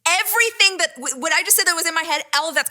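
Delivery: high, loud, incredulous confession; loud high punchline; mortified reveal. Real, not acted. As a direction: blurt it out, mortified. Mortified climax of the story.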